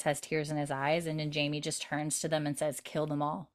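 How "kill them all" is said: quiet cold rage. Retold or imitated. Jamie quietly orders his men, with a hint of restrained fury. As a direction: hold the rage under a quiet voice. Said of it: "He's very calm, but you can fucking see the rage".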